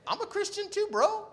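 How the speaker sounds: high-pitched, chummy announcement; eager retold confession. Medic tells his partner he shares the faith, high eagerness.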